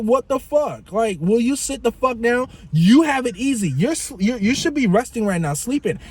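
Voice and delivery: high, fed-up telling-off; exasperated scolding. Retold or imitated. Driver telling the passenger to sit down and sleep, high fed-up scolding.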